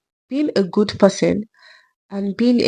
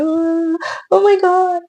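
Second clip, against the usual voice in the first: high, touched gasp of feeling; moved exclamation; 10 semitones higher, slower than usual. Retold or imitated. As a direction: react as if moved by a video.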